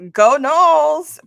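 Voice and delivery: loud, slow, high sports chant; fan cheer. Retold or imitated. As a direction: cheer it out slowly for your team. Retold fan cheer to herself.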